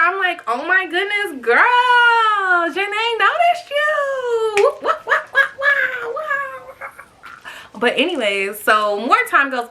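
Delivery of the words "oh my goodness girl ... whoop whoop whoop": high cheering with a laugh; excited cheer. Retold or imitated. Mom cheering daughter noticed by a singer, high whooping cheer. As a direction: hype her up, whooping. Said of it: "super excited for her".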